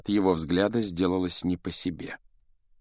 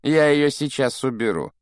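slightly higher, mock-genial offer; feigned good nature; 5 semitones higher, 3 dB louder than usual. A narrator's reading. Professor toying with a frightened boy, mock-genial. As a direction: offer help with put-on kindliness. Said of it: "с наигранным добродушием произнёс Снегг".

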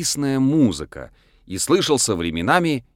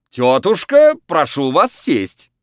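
high, loud stage command; loud clown call; 6 semitones higher, 6 dB louder, slower than usual. A narrator's reading. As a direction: call it across the ring. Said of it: "крикнул хозяин".

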